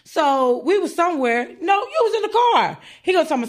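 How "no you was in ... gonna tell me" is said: normal talking voice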